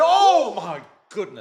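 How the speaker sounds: loud, high, drawn-out exclamation; stunned, stretched commentator's cry; excited outcry. Real, not acted. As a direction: burst out at a near miss. Reaction to a near goal.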